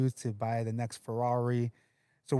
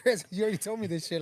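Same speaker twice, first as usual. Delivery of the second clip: higher, half-laughing retold complaint; mock exasperation; 8 semitones higher, faster than usual. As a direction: act out being fed up with a friend's repeats. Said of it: "I get mad at him".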